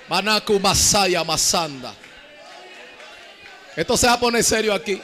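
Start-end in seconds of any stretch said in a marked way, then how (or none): 0.1-1.9 slow, low tongues; speaking in tongues. Real, not acted. Preacher speaking in tongues before the church. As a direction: pray in tongues under the breath.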